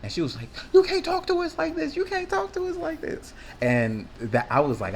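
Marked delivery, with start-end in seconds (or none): 0.7-3.3 much higher imitated angry girl; mocking girl voice. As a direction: mimic a furious girl scolding you. Imitating the girl's friend.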